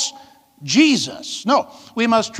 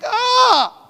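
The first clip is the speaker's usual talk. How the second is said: exasperated cry; 12 semitones higher than usual. Acted. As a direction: cry out as if you can't stand it. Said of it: "I can't stand it".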